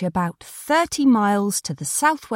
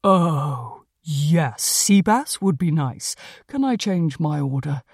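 low, unhurried cat character voice; talking cat Conrad, pleased; 3 semitones lower than usual. Acted.

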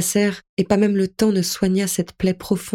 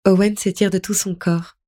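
usual speaking voice

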